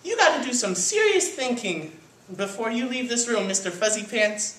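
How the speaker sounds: high-pitched scolding mama voice; stern cartoon mother, pitched high; lecturing mother. A narrator's reading. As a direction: tell off a naughty kitten. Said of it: "said his mother".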